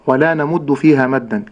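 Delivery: ordinary talk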